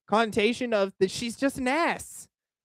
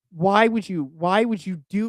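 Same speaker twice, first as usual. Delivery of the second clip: usual speaking voice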